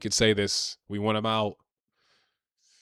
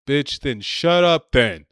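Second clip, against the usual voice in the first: louder, slowed, spat-out retort; angry outburst; 4 semitones higher, 7 dB louder, slower than usual. Real, not acted. Ranting at the crying celebrity, a louder slowed angry outburst. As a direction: really lose it on the insult.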